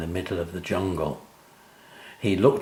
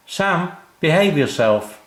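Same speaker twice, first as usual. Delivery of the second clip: high, louder call from the kitchen; mum shouting; 8 semitones higher, 3 dB louder than usual. A narrator's reading. Mum scolding Sam from the kitchen, shouting. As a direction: scold him from another room. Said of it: "shouted Mum from the kitchen".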